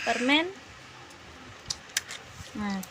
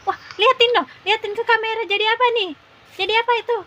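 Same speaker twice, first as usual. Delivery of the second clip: high, loud and thrilled; excited; 8 semitones higher, 5 dB louder, faster than usual. Real, not acted. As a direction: cheer at the camera, high up. Seeing the blue tongue.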